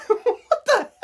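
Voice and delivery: high incredulous squeak at the quiz; baffled squeal. Real, not acted. Vlogger squeaking in disbelief at a quiz option, high and baffled.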